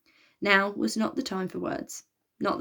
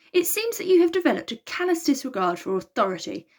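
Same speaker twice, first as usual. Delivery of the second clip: huffy; 7 semitones higher than usual. A narrator's reading. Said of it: "huffed Ares".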